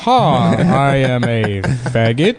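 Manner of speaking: mock laugh, then slow celebrity impression; George Takei. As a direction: ham up a celebrity, stretching every word. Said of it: "not a particularly good George Takei".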